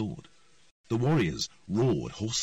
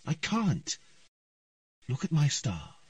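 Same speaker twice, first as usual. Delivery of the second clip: put-on higher little girl voice; young girl voice; 8 semitones higher than usual. A narrator's reading. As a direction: plead it like a small girl. Ciri speaking.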